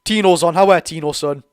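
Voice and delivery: loud cheer for Tino; cheering shout. Real, not acted. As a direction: bellow your welcome to the sub. Fan cheers sub coming on.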